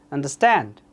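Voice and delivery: understand with the beat on stand, falling; third-syllable stress. Shown as a demonstration. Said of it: "Definitely the third one". (understand-এর শেষ সিলেবলে জোর)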